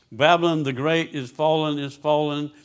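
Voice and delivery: put-on "Babylon the great is fallen" proclaimed loud and high; proclaiming cry. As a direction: cry it like a mighty angel. Angel's mighty cry read aloud.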